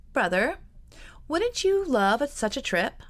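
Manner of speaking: coaxing, lifting high on wouldn't you love; sly. A narrator's reading. Georgiana pressing her brother for the trip, slyly coaxing. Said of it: "she asked slyly".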